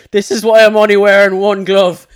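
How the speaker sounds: loud mock-triumphant taxi driver; loud taxi driver reveal. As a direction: thrust it at him, loud and high.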